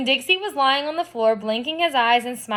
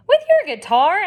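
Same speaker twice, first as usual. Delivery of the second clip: surprised; 8 semitones higher, 5 dB louder than usual. Acted. Surprised at guitar offered.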